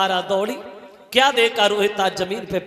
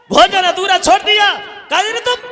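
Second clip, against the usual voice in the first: angry shout; 10 semitones higher, 6 dB louder than usual. Acted. Brahmin scolds Tara for leaving.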